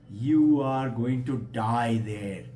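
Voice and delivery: slow, low pronouncement; ominous warning. Acted. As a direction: deliver the grim news slowly and low. Friend warns wave of death.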